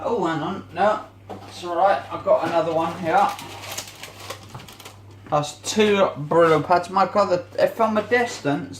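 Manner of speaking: ordinary delivery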